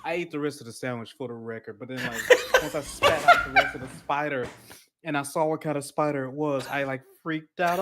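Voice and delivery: normal talking voice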